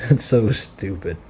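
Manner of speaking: said through a laugh; amused, laughing disbelief. Real, not acted. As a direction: crack up while commenting. Laughs at the absurd scene.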